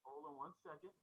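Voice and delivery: faint, muffled through a wall; bad mic, voice muffled and distant. Real, not acted. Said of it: "the, uh, mic went out".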